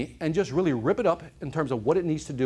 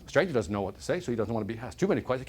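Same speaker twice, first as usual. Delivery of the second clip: ordinary delivery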